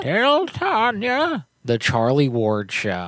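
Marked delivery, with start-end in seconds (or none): 0.0-1.5 much higher, slow sing-song echo of Tell Tanya; squeaky high echo. Pretend. Echoes in a silly high voice.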